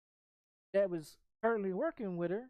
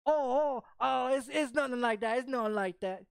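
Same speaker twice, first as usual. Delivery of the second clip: panicked denial imitated; 4 semitones higher, 3 dB louder than usual. Man voiced, panicked denial.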